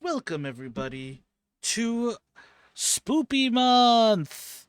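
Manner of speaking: high, slow Spoopy Month; drawn-out spooky announce. Acted. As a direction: stretch out Spoopy Month like a ghost. Spooky stream intro.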